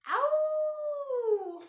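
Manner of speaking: sharp, high yelp of pain; high loud yelp. Acted. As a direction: yelp like you got pricked. Hedgehog pricks the fox.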